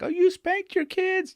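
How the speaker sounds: mocking a disapproving parent in a very high voice; squeaky, pitched-way-up mock outrage; shocked parent. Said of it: "a lot of parents are listening right now like".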